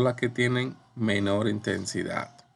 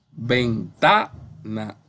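ventana very slow, ven-TA-na, TA raised; stress on TA; much slower than usual. Shown as a demonstration. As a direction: stretch ventana out and punch the middle TA. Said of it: "Bien, esa suena bien".

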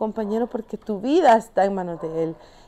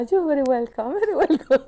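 giddy, rising into laughter; super happy reply; 8 semitones higher than usual. Retold or imitated. Sherpa answering the climber's thanks, imitated as super happy. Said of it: "los dos íbamos como súper felices".

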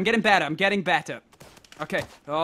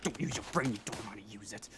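1 muttering to himself; 12 semitones lower, 17 dB quieter, faster than usual. Real, not acted. Berating himself.

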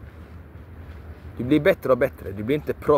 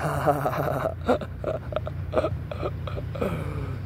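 soft laugh; 3 semitones higher, 6 dB quieter than usual. Real, not acted. Long laugh after "skratta.

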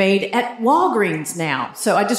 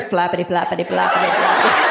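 low, rapid run of flapping noises; imitated flapping; 5 semitones lower than usual. Describing runners with no shorts or jog bra, comic flapping sound. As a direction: rattle it off like loose flesh slapping along. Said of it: "all you hear is".

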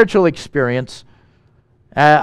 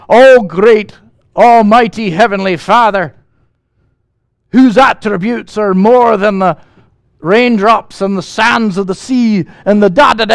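loud mock pulpit prayer, higher pitched; wordy praying preacher; 5 semitones higher, 6 dB louder than usual. Young preacher launching into a wordy prayer, mock-lofty and loud. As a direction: lightly act out a long-winded preacher addressing God. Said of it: "he's launching into this wordy prayer".

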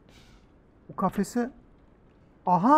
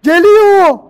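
very high, loud panicked shriek; terrified scream; 12 semitones higher, 16 dB louder than usual. Real, not acted. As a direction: shriek as the monster comes. Shark charging at him.

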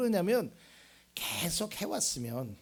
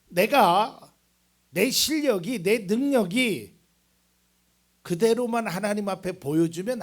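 loud, raised preaching voice; 6 semitones higher, 12 dB louder than usual. Real, not acted. Preacher tells congregation about God and ability, preaching loudly. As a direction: drive each phrase home at full force. Preacher rises to full force.

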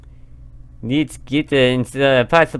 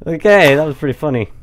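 ordinary talk

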